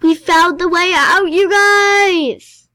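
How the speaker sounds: gasp, then a high, loud shout of triumph; excited yell. Real, not acted. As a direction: shout the great news to viewers. Finds the exit in game.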